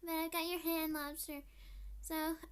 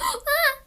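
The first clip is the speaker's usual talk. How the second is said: sharp gasp into a high, loud cry; frightened gasp and yelp; 8 semitones higher, 13 dB louder than usual. Pretend. Frightened by the shaking boat.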